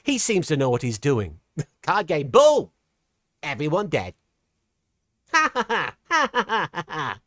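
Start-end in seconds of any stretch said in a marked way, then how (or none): 1.8-4.1 theatrical villain, one word suddenly loud; Paradox. Acted. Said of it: "who am I to question Paradox". 5.3-7.2 deliberate ha-ha cackle starting high; staged villain laugh. Acted. Mock villain laugh after the impression.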